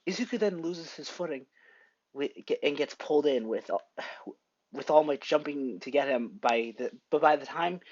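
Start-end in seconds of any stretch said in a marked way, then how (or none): none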